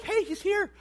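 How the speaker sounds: put-on high, loud and eager, like kids greeting a friend; eager excitement. Preacher voicing Adam and Eve thrilled to see God. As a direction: gush with joy that he's here. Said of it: "they'd be like".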